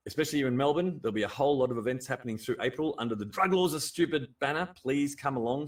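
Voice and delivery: ordinary talk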